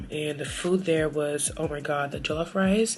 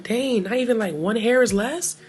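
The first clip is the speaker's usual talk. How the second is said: retold disbelief; 5 semitones higher, 6 dB louder than usual. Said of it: "I was like".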